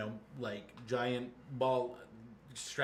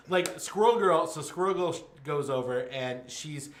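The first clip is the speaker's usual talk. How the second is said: normal talking voice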